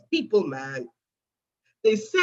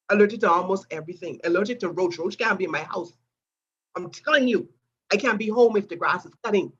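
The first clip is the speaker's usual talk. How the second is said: ordinary talk, nothing marked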